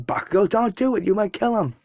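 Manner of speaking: All Might shouting to stop Bakugo, urgent call; calling out a warning. Acted.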